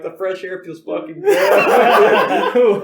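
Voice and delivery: put-on laughing drunk. Drunk friend hanging out the car window answering his buddy, laughing slowly. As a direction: giggle it out slowly, blissfully drunk. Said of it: "And I'm like".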